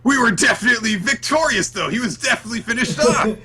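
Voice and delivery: high, loud indignant wail; mock panicked protest. Host acts out Noelle protesting Veto's revival, mock frantic. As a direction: insist frantically, hammed up for laughs.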